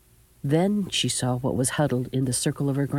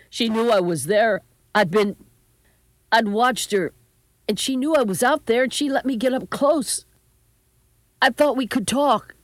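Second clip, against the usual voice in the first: high, urgent, pleading grandson; upset, halting explanation; distressed justification; 7 semitones higher, 4 dB louder than usual. A narrator's reading. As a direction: plead to be understood, getting higher. Grandson frantically justifying himself.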